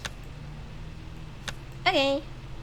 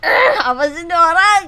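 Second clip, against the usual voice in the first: loud mock-annoyed grumble; annoyed imitation; 3 semitones higher, 10 dB louder than usual. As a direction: imitate an irritated onlooker. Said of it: "dengan wajah seperti ini sih".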